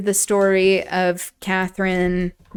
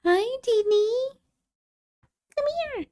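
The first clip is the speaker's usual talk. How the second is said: high, sweet voice for a pet; high cooing greeting; pet talk; 7 dB quieter than usual. Real, not acted. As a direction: coo at the dog coming over.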